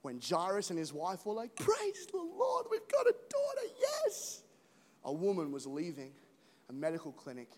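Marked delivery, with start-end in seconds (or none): 1.5-4.4 much higher, slow joyful cry; new parents crying out praise, very high and overjoyed; ecstatic praise. Retold or imitated. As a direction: cry out with joy over a new baby. Parents celebrate newborn daughter.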